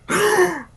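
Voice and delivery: loud, high gasp of shock; sharp high gasp. Acted. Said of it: "Tracy gasped".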